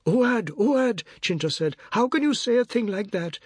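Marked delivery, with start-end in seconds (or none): 0.0-1.0 high-pitched reproachful calling; high appalled cry; shocked woman. A narrator's reading. Woman scolds the shouting boy. 1.9-3.4 high-pitched reproach; appalled high protest; shocked woman. A narrator's reading. Woman scolds the shouting boy.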